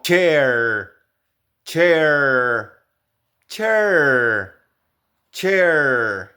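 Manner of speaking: chair with a clear ch sound. Shown as a demonstration. Teacher modelling chair with a ch sound. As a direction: drill chair, opening with a crisp ch. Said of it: "por ejemplo".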